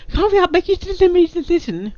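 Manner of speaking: a lightly voiced high, fast countdown nag; high, hurried urging in a put-on voice; mock timer voice. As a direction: rush them like an impatient announcer.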